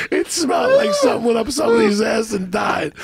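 high, loud, half-laughing mock yell; hammed-up outraged stranger; junkie on the bus. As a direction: keep playing the disgusted guy, shouting. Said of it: "he goes".

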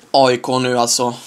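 ordinary talk, nothing marked

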